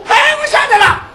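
a high, loud eureka cry; excited shout. Acted. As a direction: burst out with the answer. Boy suddenly remembers the name. (兴奋地高声喊)

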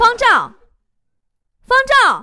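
high, loud, impatient name-calling; exasperated shout. Acted. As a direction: yell the name, at the end of your patience. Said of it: "老师就急眼了".

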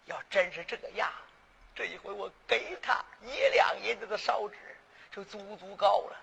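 low, soft, musing shopkeeper; aside to self. Acted. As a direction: think it over privately, quiet and low with long pauses. 掌柜暗自盘算的内心独白. (低声自语，暗自盘算)

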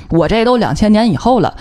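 ordinary talk

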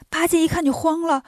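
plain reading voice

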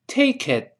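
"take it" run together, final t unreleased; linked "take it". Shown as a demonstration. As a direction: say "take it" as "tay-kit", t held. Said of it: "这是连读了".

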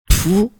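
sudden high spluttering pfft; spray out a mouthful of water; spit take. A narrator's reading. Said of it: "苏大为差点一口水喷出来". (噗地喷水声)

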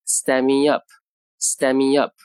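"stand me up" with the d dropped and words run together, slowed; careful slow demo of "stand me up"; linked stand me up. Shown as a demonstration. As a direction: model "stand me up" as one chunk. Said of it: "所以stand me up就读成".

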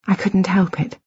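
ordinary delivery, nothing marked

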